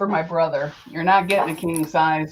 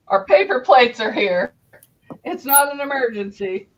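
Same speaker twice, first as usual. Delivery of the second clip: higher, louder laughing announcement; 6 semitones higher, 4 dB louder than usual. Real, not acted. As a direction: laugh through the words. Laughing at her false alarm.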